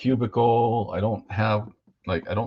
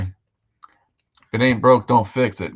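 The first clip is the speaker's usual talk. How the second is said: put-on folksy voice; 4 semitones higher, 7 dB louder, slower than usual. Saying in put-on voice.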